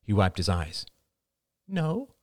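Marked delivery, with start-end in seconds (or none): 1.7-2.1 put-on soft, high child's voice; small, furtive refusal, pitched high; little boy. A narrator's reading. Son declines mother's company while eating candy, small voice. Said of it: "Ben's face darkened furtively".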